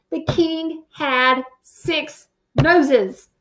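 plain reading voice

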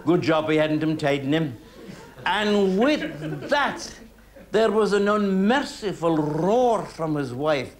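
ordinary delivery

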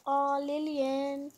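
slow, drawn-out mock pity; teasing coo. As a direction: coo at a scaredy dog. Teasing dog that is scared.

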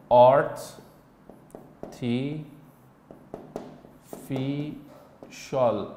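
آرت تی فی شال spelled out slowly with long pauses between syllables; dictation, syllable by syllable. Shown as a demonstration. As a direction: dictate آرت، تی، فی، شال one by one for note-taking. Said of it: "شما هم یادداشت بکنید". (آرت تی فی شال را هجا به هجا و با مکث برای دیکته بگو)

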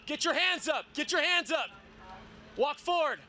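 ordinary talk, nothing marked

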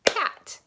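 Cat said as one clapped beat. Shown as a demonstration. Teacher claps out one syllable for kids. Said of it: "let's clap it out".

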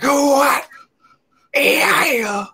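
a hammed-up crunk hype man. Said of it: "I was impersonating [name]".

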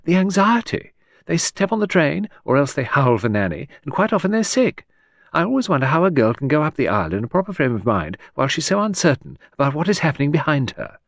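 lilting high society lady; chattering aristocrat. Acted. Hostess prattling to Edward about the wedding.

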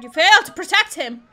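loud, high outburst reading the failure message; indignant shout. Acted. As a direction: shout the verdict in outraged disbelief. Reads failure message aloud, indignant.

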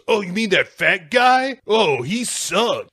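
high-pitched dismissive exclamation; mock scornful. [name] asked about the fat guy, mock scornful. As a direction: dismiss him in a high, whiny voice.

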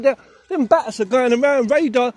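excited laughing. Real, not acted. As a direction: laugh and shout at the swooping bats. Bats swooping, laughing loudly.

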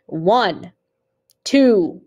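counting aloud. A narrator's reading. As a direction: count out each number firmly. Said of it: "he was counting".

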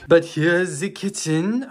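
mock French accent, slow and lilting; playfully French-accented tour guide line; hammed-up French accent. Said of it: "I'm gonna stop the French accent".

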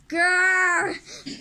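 loud, high growl; a loud grouchy grr; angry growl. Acted. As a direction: growl awake in a foul temper. Slug King wakes up grouchy.

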